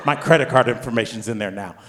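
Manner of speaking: usual speaking voice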